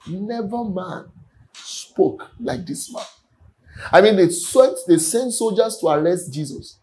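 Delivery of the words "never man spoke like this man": awed hush. Acted. Officers awed at Jesus.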